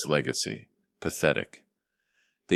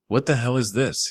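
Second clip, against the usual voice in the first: a touch higher, sharp demand; 7 semitones higher, 3 dB louder than usual. A narrator's reading. Son-in-law confronting the father at the door, a mild angry demand. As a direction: confront the intruder. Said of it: "he demanded".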